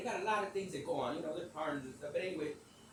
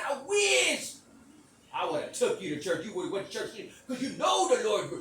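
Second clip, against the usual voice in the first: loud outburst, WISH pitched high; retold scolding; 6 semitones higher, 10 dB louder than usual. Speaker telling Sweet about church, loud and heated. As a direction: scold her at full voice.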